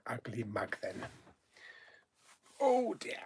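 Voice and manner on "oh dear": ordinary talk, nothing marked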